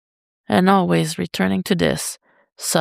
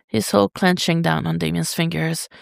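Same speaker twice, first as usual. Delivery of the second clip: plain reading voice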